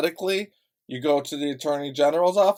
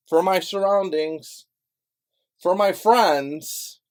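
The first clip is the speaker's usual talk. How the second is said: louder and higher, very slow; drawn-out pointed emphasis; slow emphatic declaration; 6 semitones higher, 6 dB louder, much slower than usual. Real, not acted. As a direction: lean hard on "my" each time. Emphatic address to audience.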